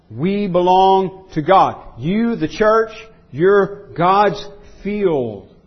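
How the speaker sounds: emphatic preaching. Real, not acted. Preacher telling the church who owns it, slow emphatic preaching.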